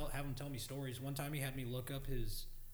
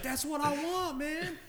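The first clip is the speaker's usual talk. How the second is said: put-on a high voice for the old biker; high mock biker voice; 10 dB louder, slower than usual. Retelling the biker's eager reply, higher and louder. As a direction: mimic the biker high and loud.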